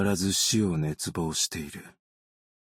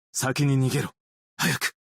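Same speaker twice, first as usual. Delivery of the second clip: raised, urgent call to run; urgent shout; 6 semitones higher, 3 dB louder than usual. Acted. Rear guard urging the others to flee, urgent shout. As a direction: yell at them to get out now.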